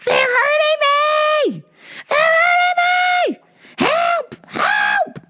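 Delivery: high, drawn-out mock scream; imitated screaming. Woman in handcuffs screaming at officers, high mock wail. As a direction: wail it like a hysterical arrestee.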